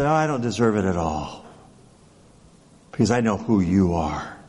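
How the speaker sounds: bow your head and admit it gently; a humble centurion, voice dropped low. Retold or imitated. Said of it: "But he said".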